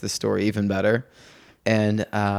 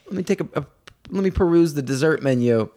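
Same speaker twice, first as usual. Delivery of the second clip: put-on polite, composed kids; 7 semitones higher than usual. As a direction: imitate a child politely pondering the menu. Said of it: "They were like".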